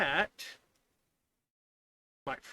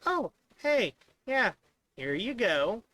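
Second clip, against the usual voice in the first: much higher, louder, chirpy helper; cheerful program voice; 6 semitones higher, 5 dB louder than usual. Retold or imitated. The code happily hands the coder a value, high and chirpy. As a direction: play the code as a bubbly helper. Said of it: "And then it's like".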